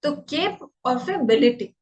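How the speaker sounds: "केप" then "बिलिटी", said in two clear parts; syllable by syllable; split into syllables. Shown as a demonstration. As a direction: break "कैपेबिलिटी" into "केप" and "बिलिटी". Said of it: "तो कैपेबिलिटी".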